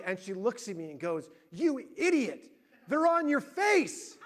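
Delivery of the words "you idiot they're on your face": slow, high, incredulous mock scolding; exasperated scolding. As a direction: imitate a wife spelling out the obvious, slowly. Wife scolds him over glasses.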